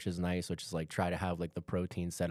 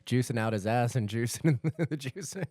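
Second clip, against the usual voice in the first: laughing loudly; 5 semitones higher, 5 dB louder than usual. Real, not acted. Joking about juicing.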